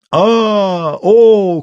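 high, loud drawn-out wail; long high-pitched yell; old man's cry. A narrator's reading. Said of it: "кричал на печке старик".

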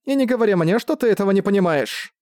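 high, insistent protest; exclaiming. A narrator's reading. Accused woman challenging her judge, exclaiming. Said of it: "воскликнула она".

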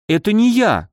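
high-pitched, mildly alarmed denial; a touch of fright, pitch jumping up; слегка испуганно. A narrator's reading. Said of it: "даже слегка испугалась близняшка". (с лёгким испугом)

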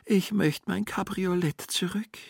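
whispered moan, pitched high; high whispered moan slumped on the sofa. A narrator's reading. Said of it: "und stöhnte".

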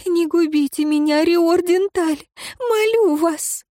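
high, sobbing plea that climbs; tearful pleading. A narrator's reading. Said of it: "надрывно всхлипнула".

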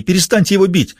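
high, desperate cry to stop; pleading shout. Acted. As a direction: yell at him to stop hitting. Woman jumps up shouting.

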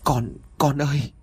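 mother bursting into tears at her son's bedside. A narrator's reading. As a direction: cry out to her sick child. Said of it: "thốt lên rồi òa khóc".

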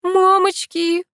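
high, drawn-out "oh mummy" cry; long high yelp of dread; frightened gasp. A narrator's reading. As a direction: wail it slowly in alarm. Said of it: "охнула я".

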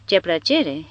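ordinary delivery

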